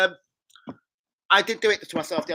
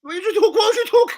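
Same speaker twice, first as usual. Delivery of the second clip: laughing high through the question; cracking up mid-question; giggling disbelief at a mate; 10 dB louder than usual. Real, not acted. Laughing at a mate's remark.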